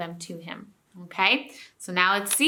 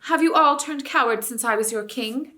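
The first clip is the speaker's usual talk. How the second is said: louder, higher reproach; reproachful call; 4 semitones higher, 7 dB louder than usual. A narrator's reading. King rebuking cowardly animals, loud reproach. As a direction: shame them into coming. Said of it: "he called".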